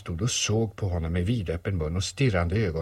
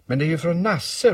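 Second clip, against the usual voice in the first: high, louder cry of discovery; exclaiming; 10 semitones higher, 6 dB louder than usual. Acted. Boy has read the message, exclaiming. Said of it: "ropade Christopher Robin".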